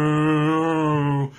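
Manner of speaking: a loud, drawn-out slow-motion "No"; dragged-out movie slow-mo cry. Retold or imitated. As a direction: stretch it like a film in slow motion. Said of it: "It goes in slow motion".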